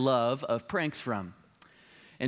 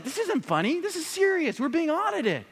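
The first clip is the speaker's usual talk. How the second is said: much higher, strained objection; upset protest; 13 semitones higher than usual. Retold or imitated. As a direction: insist it's serious, rattled. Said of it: "He did not find it so funny".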